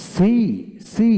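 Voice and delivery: "সি" loud, with a stretched ee; lengthened ee. Shown as a demonstration. Teacher models "সি" for learners, stretching the ee. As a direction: stretch the vowel of "সি" into a long ee. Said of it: "কিছুটা দীর্ঘিত উচ্চারিত হবে".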